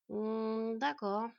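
drawn-out reluctant acceptance; a touch of reluctance; grudging agreement. A narrator's reading. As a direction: give in half-heartedly. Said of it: "bien qu'un peu à contre-cœur".

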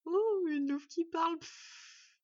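slow, loud, high silly voice; snorting mockery. A narrator's reading. Raven mocks the talking wolf, snorting. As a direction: gape in mock wonder, end in a puff. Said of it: "commenta Murk en pouffant".

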